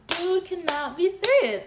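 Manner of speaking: loud, high, drawn-out outburst; exasperated disbelief. Retold or imitated. Woman blocked in her car, loud exasperation. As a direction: stretch it out in high-pitched disbelief.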